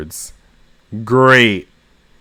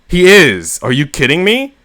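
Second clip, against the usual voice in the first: outraged disbelief; 7 semitones higher, 3 dB louder than usual. Acted. Ed swerves the boat, outraged.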